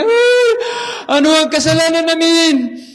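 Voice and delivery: high, loud wailing cry, voice straining; crying again. Retold or imitated. Old man weeping again before the missionary, loud wail. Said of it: "umiyak uli yung matanda".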